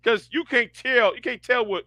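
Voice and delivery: ordinary talk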